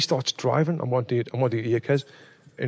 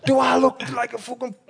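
high-pitched indignant protest; retold outrage; 10 semitones higher than usual. Telling mates how he challenged the driver, high indignant voice. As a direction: re-enact your offended comeback, voice way up. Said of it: "just proper angry".

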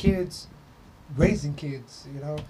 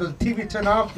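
ordinary talk

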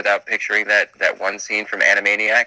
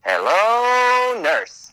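high swooping Hello, then breathy nurse; mock cartoon voice; Hello nurse catchphrase; 12 semitones higher, 3 dB louder, much slower than usual. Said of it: "He said".

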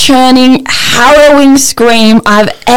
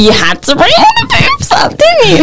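teasing; 13 semitones higher than usual. Real, not acted. Teasing friend about the topic.

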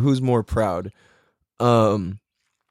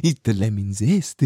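Filipino accent attempt; 5 semitones lower than usual. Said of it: "can't I not do Filipino accent".

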